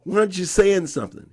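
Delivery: quick, loud and high; exasperated demand. Retold or imitated. Boy demanding Ashe speak out, exasperated. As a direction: press him to speak up. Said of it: "I'm going".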